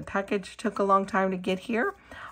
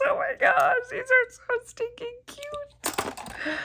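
high cooing. Real, not acted. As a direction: coo at them like at a puppy. Cooing at acrylic cows.

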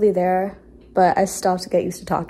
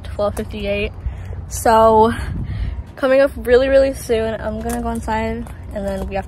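talking around a finger; speaking with finger on lips; 7 dB louder than usual. Real, not acted. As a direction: hand touching mouth. Seen 1: finger held against lips while talking, until 3.2.

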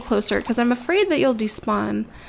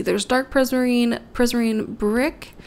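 ordinary talk, nothing marked

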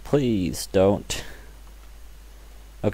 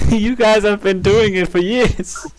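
mocking high voice; 10 semitones higher, 5 dB louder than usual. Teasing friends about spooning.

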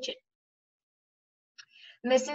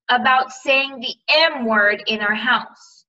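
louder, slow, raised uncle; a read-aloud uncle roaring, kept mild; 4 semitones higher, 7 dB louder, slower than usual. A narrator's reading. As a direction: read the roar with a little force. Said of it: "roared Uncle Vernon".

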